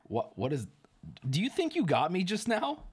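ordinary delivery

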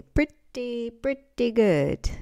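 slow, knowing "pretty, pretty good"; Curb Your Enthusiasm catchphrase. Retold or imitated. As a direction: stretch it like the sitcom catchphrase. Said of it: "Curb Your Enthusiasm".

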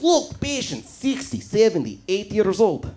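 lightly put-on Russian accent, blunt doctor; a hint of a blunt Russian doctor reading ages. Rude Russian doctor listing patients' ages, mild Russian accent. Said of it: "rude Russian doctor".

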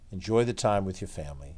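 ordinary delivery, nothing marked

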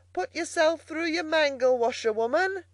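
high, jeering call. A narrator's reading. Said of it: "she called out".

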